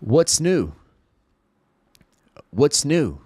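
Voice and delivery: What's new said cleanly with falling tune; clear model. Shown as a demonstration. Teacher modeling the phrase carefully. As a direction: model it for them to copy. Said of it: "listen up and listen carefully".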